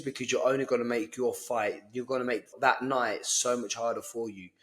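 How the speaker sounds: ordinary talk, nothing marked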